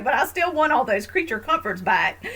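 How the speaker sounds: high, loud complaint at oneself; mock protest. Woman mocking her own longing for comforts, mock protest. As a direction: protest playfully, voice pushed up.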